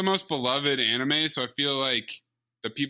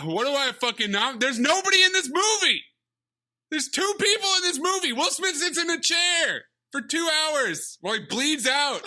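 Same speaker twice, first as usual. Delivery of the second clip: exasperated outburst; 10 semitones higher than usual. Real, not acted. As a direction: rant in comic outrage. Exasperated rant about the movie.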